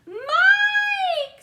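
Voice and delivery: very high, very loud scream; mother's scream. A narrator's reading. Mother screaming after her running son, very high. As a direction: scream her son's name. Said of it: "screamed Missus T V".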